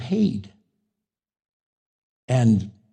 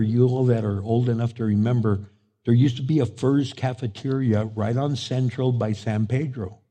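ordinary delivery

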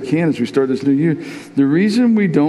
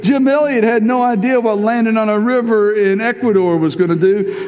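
full-force preaching; 9 semitones higher than usual. Acted. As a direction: preach it, sweeping the church along. Preaching climax after the quote.

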